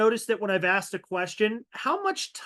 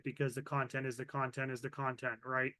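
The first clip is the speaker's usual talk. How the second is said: flat droning monotone, much lower and softer; 7 semitones lower, 12 dB quieter than usual. Shown as a demonstration. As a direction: drone it out low and quiet like a bored lecturer. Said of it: "like this".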